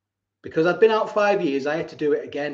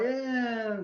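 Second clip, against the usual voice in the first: laid-back yeah; 7 semitones higher, 6 dB quieter than usual. Retold or imitated. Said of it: "The guard room was like".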